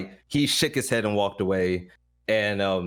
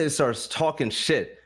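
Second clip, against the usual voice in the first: normal talking voice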